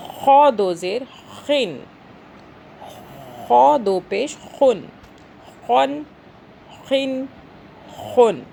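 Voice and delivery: خو and خن with a heavy scraped KH; thick kha. Shown as a demonstration. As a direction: scrape each خن fully from the throat. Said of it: "خو کو موٹا کریں گے". (خو موٹا، حلق سے)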